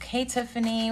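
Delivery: ordinary talk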